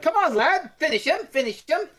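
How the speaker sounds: high, loud barking sergeant voice; drill sergeant. Retold or imitated. Sergeant urging a boy in a wrestling hold, loud bark. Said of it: "the sergeant's like".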